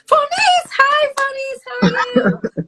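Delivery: high, loud, delighted call of a name; excited greeting. Real, not acted. Greeting a friend in live chat.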